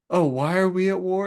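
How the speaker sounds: mock-exasperated echo, pitched high; high, put-out imitation of an irritated questioner; annoyed Horus. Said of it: "Horus is just so annoyed".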